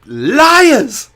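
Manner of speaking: high, loud, drawn-out accusing cry; hammed-up howl of betrayal; mock outrage. Customer served fries at a British pub, mock-outraged cry. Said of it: "and I was like".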